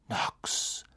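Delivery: soft unvoiced whisper. A narrator's reading. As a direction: breathe the spell silently. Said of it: "flüsterten sie wie aus einem Mund". (geflüstert)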